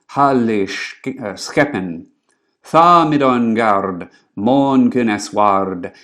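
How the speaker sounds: put-on slow, deliberate Northumbrian Old English reading; measured Old English poem recital; Old English recitation. Shown as a demonstration. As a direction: chant each half line slowly in reconstructed Old English. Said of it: "read it out loud, in what we think to be the right pronunciation".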